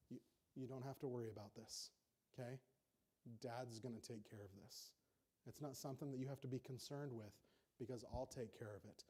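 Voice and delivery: soft, gentle reassurance; calm a frightened child; soothing dad. Retold or imitated. Father comforting his scared child at bedtime, soft and gentle.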